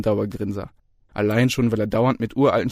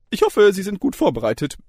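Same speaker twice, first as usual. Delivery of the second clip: much higher, louder cheery voice; jovial lecturer; 9 semitones higher, 6 dB louder than usual. A narrator's reading. Lecturer opens the exam, cheery and high. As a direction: chirp it brightly.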